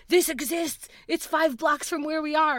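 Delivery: excited. Retold or imitated. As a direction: share the find with thrilled disbelief.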